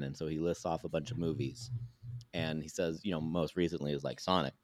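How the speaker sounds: normal talking voice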